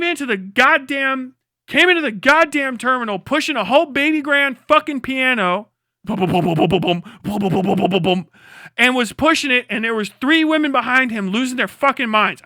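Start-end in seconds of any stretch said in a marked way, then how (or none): 6.0-8.3 low rhythmic booms; imitated thudding. Comic imitating a piano rolling through the terminal, low thuds.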